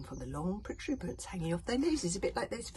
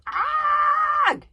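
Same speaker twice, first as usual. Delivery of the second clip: a sudden, much higher, much louder yelp; scream; 16 dB louder than usual. A narrator's reading. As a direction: let out a terrified full-throated scream. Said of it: "he screamed".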